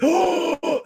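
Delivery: very high, amazed "oh"; blown away. Retold or imitated. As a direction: imitate someone utterly astonished. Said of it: "blown away".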